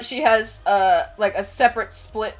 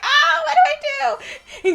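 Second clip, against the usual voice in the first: mock panic; 4 dB louder than usual. Elf girl after singeing a mustache, panicked wail. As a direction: wail helplessly like a little elf. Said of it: "She's like".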